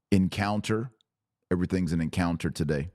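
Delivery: ordinary talk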